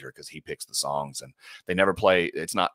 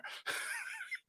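high laugh; 15 dB quieter than usual. Real, not acted. Laughing at daughter's question.

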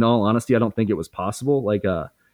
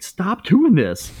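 pleading shout; 10 semitones higher, much slower than usual. Retold or imitated. Telling screaming partner to stop.

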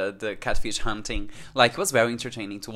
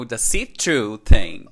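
normal talking voice

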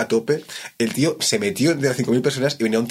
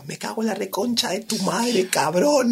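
high, heated mock rant; furious cursing; 11 semitones higher, slower than usual. The sound tech curses him for losing the flask, high and angry. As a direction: spit out the insult like a furious tech.

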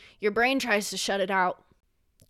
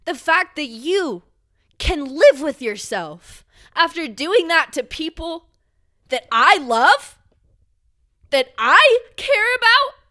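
slow, loud furious words rising much higher at the end; real fury; 5 semitones higher, 6 dB louder than usual. Real, not acted. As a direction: spit it out slowly, louder and louder. Raging at studio over abuse.

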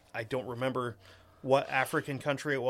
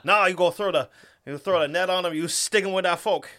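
loud, higher-pitched Cajun caricature; broad mock Cajun accent; Louisiana Cajun accent; 4 semitones higher, 6 dB louder than usual. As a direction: coach like a hammed-up Louisiana frog gigger. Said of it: "from friggin' Louisiana".

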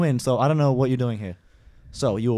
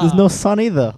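louder, raised-voice comeback; raised, emphatic objection; loud retort; 5 semitones higher, 7 dB louder than usual. Acted. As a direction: fire it back loudly. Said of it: "it's spiking so much".

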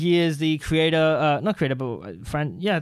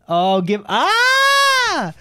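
sudden high yelp of surprise; startled scream; 12 semitones higher, 4 dB louder, much slower than usual. Real, not acted. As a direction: shriek out, caught off guard. Sudden startle mid-sentence.

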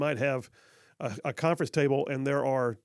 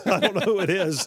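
laughing; 5 semitones higher, 5 dB louder than usual. Real, not acted.

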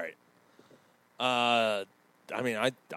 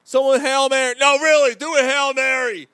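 loud high pleading shout; 10 semitones higher, 8 dB louder than usual. Pretend. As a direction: yell it desperately. Shouting about fire.

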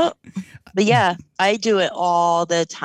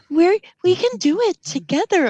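high, louder cheery ad voice; 11 semitones higher, 3 dB louder than usual. Retold or imitated. Mocking a mask commercial, a high cheery ad voice. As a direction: sell it like a mask ad. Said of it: "mask commercials".

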